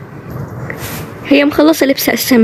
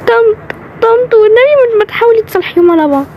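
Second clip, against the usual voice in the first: pleading; 10 semitones higher than usual. Acted. Begging aunt to reconcile them.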